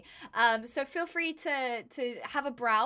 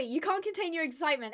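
normal talking voice

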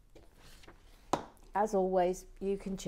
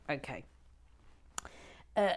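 ordinary talk, nothing marked

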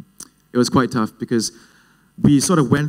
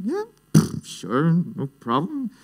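feigned nonchalance; 6 semitones higher, 4 dB quieter, slower than usual. Acted. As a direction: pitch leaping up, then drawn out slowly. Acts fake confidence to dad's demand.

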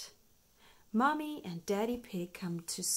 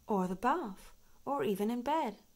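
ordinary delivery

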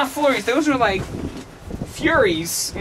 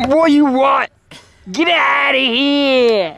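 slow loud shouted yell; shouting; 7 semitones higher, 7 dB louder than usual. Real, not acted. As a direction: yell to get them away. Yelling at mom.

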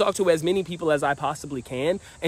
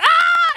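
huge, very high mock screaming cheer; a giant high-pitched cheer; crowd's scream; 7 dB louder than usual. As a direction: scream like a whole bar erupting.

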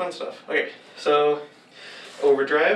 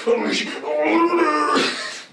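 slow, higher mechanical line; slow robot announcer; 9 semitones higher, 4 dB louder, slower than usual. Acted. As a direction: stretch it out like a machine. Another take of line.

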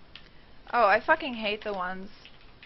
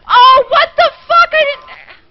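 very loud, high yell, half laugh half cry; outraged shriek; 16 dB louder, slower than usual. Real, not acted. Gamer shrieking at a sudden unfair death, outraged. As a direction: scream in disbelief at the game. Dies unexpectedly in game.

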